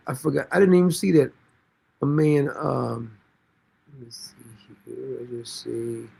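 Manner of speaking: ordinary talk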